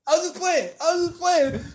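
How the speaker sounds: put-on high, laughing scared-kid plea; Little Chris from Friday. Boy one backing off after the charge, in a high laughing kid voice. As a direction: squeak it out like a kid caught in trouble. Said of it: "Like little Chris from Friday".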